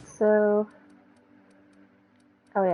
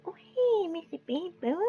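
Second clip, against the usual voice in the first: high cooing voice for a dog; 8 semitones higher than usual. Real, not acted.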